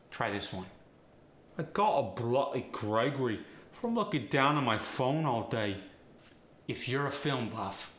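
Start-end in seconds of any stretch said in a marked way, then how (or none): 1.6-5.8 cockney accent. Shown as a demonstration. Example sentence in Cockney slang.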